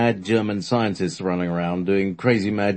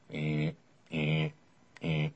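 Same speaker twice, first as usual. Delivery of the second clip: buzzer imitation; 5 semitones lower, 9 dB quieter, slower than usual.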